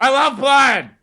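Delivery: high-pitched bloodthirsty fan yell; mock crowd shout. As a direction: holler it like a rowdy heckler. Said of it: "them shouting".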